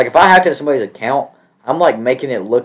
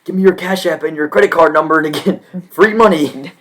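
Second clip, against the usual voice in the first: higher sleazy put-on voice; fake scammer pitch; 6 semitones higher, 3 dB louder than usual. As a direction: sell it like a shady scam bot. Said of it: "it's like".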